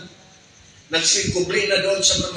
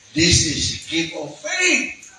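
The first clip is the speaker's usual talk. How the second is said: emphatic preaching; 10 semitones higher, much slower than usual. Acted.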